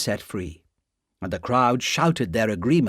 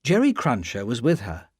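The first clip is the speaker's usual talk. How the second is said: ordinary delivery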